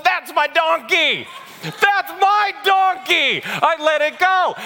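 high, drawn-out crowing cry; excited proud shout. Acted. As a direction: shout it slowly, high over a crowd. Owners cheering their donkey in parade.

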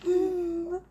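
slow, lightly voiced little girl; tiny child voice. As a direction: imitate a small child, slowly, laughing. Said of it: "your teeny tiny Eleanor voice goes".

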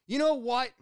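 high, loud fed-up customer; retold exasperated outburst. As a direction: snap it like a customer who's had enough. Angry customer refusing refund in retold quarrel.

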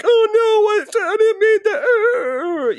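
exaggerated squeaky mock apology, dragged out; mock whiny backpedal. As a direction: ham it up like a cornered coward. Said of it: "And be like".

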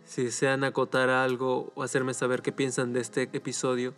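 ordinary delivery, nothing marked

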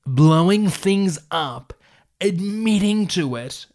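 high, drawn-out disbelief; loud, slow, incredulous recap. Real, not acted. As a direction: stretch each phrase, pitched right up. Mocks absurdity of own story.

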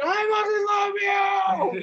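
high, stretched-out fanboy scream. Retold or imitated. Fan losing himself before his idol, screaming. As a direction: shriek it like an overwhelmed fan. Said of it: "I am screaming".

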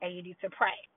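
calming mom. Retold or imitated. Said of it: "She's like".

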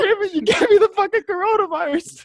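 high, strained mock yell of an angry man; mock furious yell. Angry boyfriend yelling at his girlfriend, mock furious. Acted quarrel, man choking woman.

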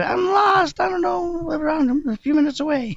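high, panicky lost voice; lost and flustered. Retold or imitated. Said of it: "I called her on the phone".

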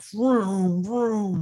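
high imitated engine vroom; car noise. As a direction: make the cars whoosh past. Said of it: "People are, like".

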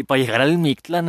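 ordinary delivery

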